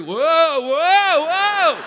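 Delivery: loud high "whoa" calls, falling each; mock alarm, very loud; warning cry. Shown as a demonstration. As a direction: yell out like you're about to fall. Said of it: "I'll give you a precursory".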